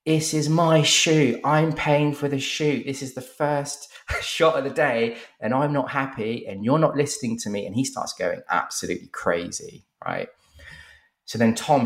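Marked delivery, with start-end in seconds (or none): 0.0-7.7 high, drawn-out mock shout; shouting client. Client shouting at Tom over the shoot, mock shout. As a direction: raise the pitch and push it like an irate client. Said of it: "he starts shouting at Tom going".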